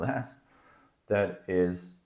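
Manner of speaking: ordinary talk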